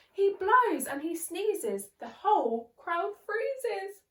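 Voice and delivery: thrilled read-aloud; excited narration. A narrator's reading. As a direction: keep the sneeze energy going. After the sneeze.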